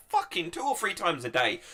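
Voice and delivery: loud, high-pitched disbelief; hammed-up appalled outburst; mock outrage. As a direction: burst out in scandalised indignation. Acted outrage at a letter.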